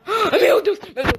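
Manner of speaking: sharp high gasp, then a frightened meu Deus; startled gasp. Real, not acted. Gamer finds skeletons in the director's house, startled gasp. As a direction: jump at the sight of skeletons.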